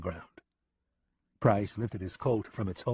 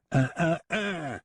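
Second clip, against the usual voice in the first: high, slow, sing-song tut-tut; a playful foreign gunman scolding; taunting Lipov; 8 semitones higher, slower than usual. Acted. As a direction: wag the words like a finger.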